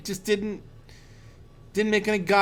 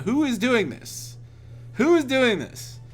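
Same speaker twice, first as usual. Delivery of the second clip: higher-pitched, insistent questioning; retold exasperated demand; 5 semitones higher than usual. Roommate confronting his two friends, exasperated demand. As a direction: press each question, exasperated, as if retelling it.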